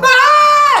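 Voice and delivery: very high, loud scream; hammed-up shriek of horror; villagers' scream. Villagers look at the bloodied man, high loud scream. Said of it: "the villagers start screaming like".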